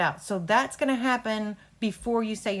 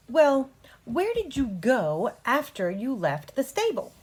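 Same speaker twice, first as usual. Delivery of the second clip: slow and a little higher; slow question; 5 semitones higher, 4 dB louder, slower than usual. Shown as a demonstration. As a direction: draw the question out gently. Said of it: "Nice and slow".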